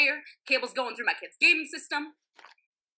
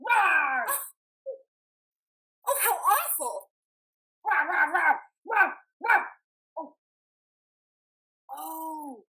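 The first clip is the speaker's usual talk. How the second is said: dinosaur voice; 4 semitones higher, much slower than usual. Acted. Jumps out in a mask.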